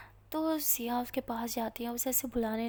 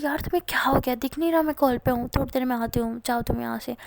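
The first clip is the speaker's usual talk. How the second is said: irritated; 9 dB louder than usual. Acted. Annoyed friend on call.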